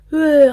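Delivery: loud gargled mock retch; mock gargling vomit. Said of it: "Whereas you, it's like".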